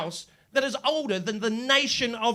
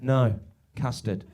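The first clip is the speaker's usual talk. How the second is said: deadpan low reply; 10 semitones lower than usual. Retold or imitated. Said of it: "he said".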